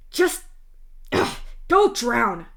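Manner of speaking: loud, furious snap; angry snap. Acted. Sophia dismissing her father before vanishing, loud angry snap. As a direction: spit it at him and leave. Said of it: "Sophia snaps at you".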